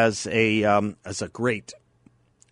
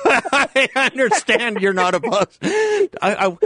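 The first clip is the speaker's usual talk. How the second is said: giggling through the words; laughing while talking; 10 semitones higher, 4 dB louder than usual. Real, not acted.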